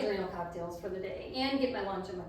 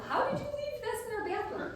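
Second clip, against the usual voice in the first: high-pitched, incredulous imitation of a husband; mock exasperated husband. Wife imagining husband's call about lost vegetables, mock exasperated husband. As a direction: squeal it as a comic imagined scolding.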